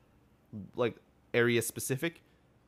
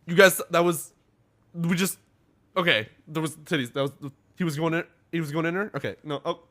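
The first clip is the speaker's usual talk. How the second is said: higher, louder, halting stammer; flustered shock; 6 semitones higher, 7 dB louder than usual. Retold or imitated. Acting out someone walking in on it, flustered. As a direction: blurt it out, stumbling and restarting.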